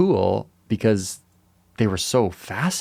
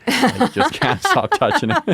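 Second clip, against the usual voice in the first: laughing; 5 dB louder than usual. Real, not acted. Cracking up at accidental double meaning.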